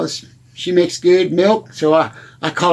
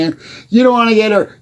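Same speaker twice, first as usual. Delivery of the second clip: ordinary delivery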